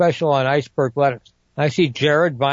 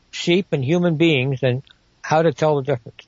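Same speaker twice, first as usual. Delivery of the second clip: normal talking voice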